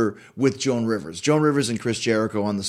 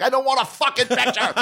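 indignant refusal; 10 semitones higher than usual. Retold or imitated. Snubbed reporter refusing the assistant, mock furious and high. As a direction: spit out the refusal in retold anger. Assistant asks for his address.

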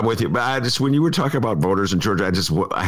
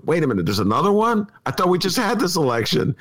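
higher, puzzled disbelief; confused voter surprised; 9 semitones higher than usual. Retold or imitated. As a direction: play a baffled voter caught off guard. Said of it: "this one guy goes".

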